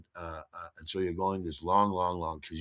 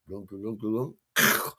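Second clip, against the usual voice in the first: gulping noise; slower than usual. Retold or imitated.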